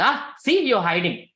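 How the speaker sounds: playful "caught you" lift; acted gleeful discovery. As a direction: pounce on it like catching someone out. Krishna playfully catches her hiding food.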